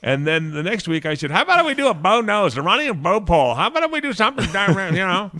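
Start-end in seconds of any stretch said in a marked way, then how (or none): 1.3-5.3 high-pitched, excited retold suggestion; mock-enthusiastic high voice quoting yourself; eager pitch. Retells his own excited suggestion.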